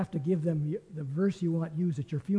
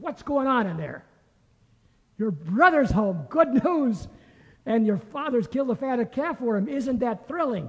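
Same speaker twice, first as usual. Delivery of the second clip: much higher and louder, breathless with good news; gushing servant; 7 semitones higher, 7 dB louder than usual. Acted. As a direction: burst out with the news like an eager servant. Servant gushes news to brother.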